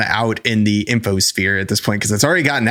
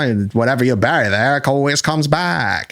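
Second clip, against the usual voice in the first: put-on New England accent; 3 semitones higher than usual. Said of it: "that's Pet Sematary".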